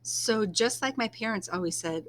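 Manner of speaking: ordinary delivery, nothing marked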